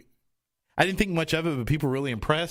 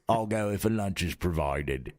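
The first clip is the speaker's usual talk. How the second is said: lower, slow put-on grumpy old voice; crotchety businessman; 7 semitones lower, slower than usual. Repeating his businessman line, lower and slow. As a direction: mutter it slowly like a stingy old man. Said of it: "the voice I used for this business guy".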